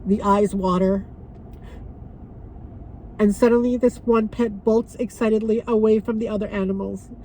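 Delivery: heartfelt memorial reading; poem reading. Acted. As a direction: read the poem gently to the viewers. Reads pet memorial poem aloud.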